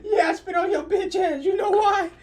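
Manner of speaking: high, slow whining mimic; whiny kid voice. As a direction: whine it like a crying child. Host mimics again, whiny.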